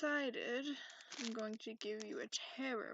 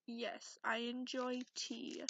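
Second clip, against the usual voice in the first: ordinary delivery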